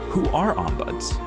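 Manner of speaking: ordinary talk, nothing marked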